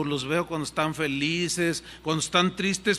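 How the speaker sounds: ordinary talk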